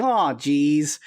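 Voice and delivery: ordinary talk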